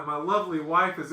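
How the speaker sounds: ordinary talk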